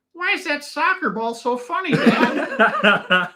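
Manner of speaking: put-on high, laughing child voice; imitating a confused kid. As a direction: squeak it out like a puzzled little boy. Son asking at first football game.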